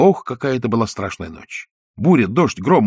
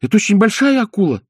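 frightened; 10 semitones higher than usual. A narrator's reading. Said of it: "замирая от ужаса".

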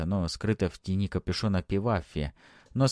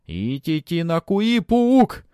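high, loud, slow accusing cry; furious priest shout; 9 semitones higher, 7 dB louder, much slower than usual. A narrator's reading. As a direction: scream the accusation.

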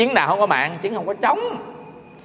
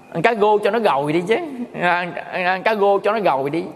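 R turned to G in gô and gồi; lazy Mekong Delta R, gô and gồi; Mekong Delta accent; 3 semitones higher than usual. Shown as a demonstration. A monk tells the audience how the Mekong Delta says the R, Mekong Delta accent. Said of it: "chữ R thành chữ G".

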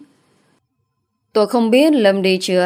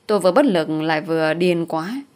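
plain reading voice

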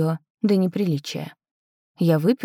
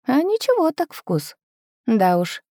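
usual speaking voice

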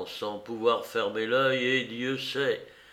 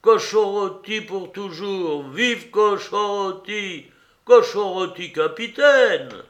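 high, loud acclamation; drawn-out rallying chant of a crowd; cheering cry; 6 semitones higher, 6 dB louder than usual. A narrator's reading. Mutineers cheer for Cochon Rôti as captain, cheering cry. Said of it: "crièrent-ils".

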